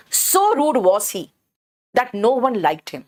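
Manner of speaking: So rude was he very slow with rude leaned on, then that no one liked him slow; teacher modelling the inverted so-sentence; stress on so. Shown as a demonstration. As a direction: spell the emphasis out word by word from So. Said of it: "so पर जोर दे रहे हैं".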